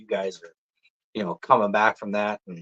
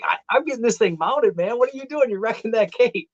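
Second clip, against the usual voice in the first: high-pitched protesting; worked-up comic complaint; retold exasperation; 12 semitones higher than usual. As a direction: scold your brother in a much higher voice. Retold phone protest to brother.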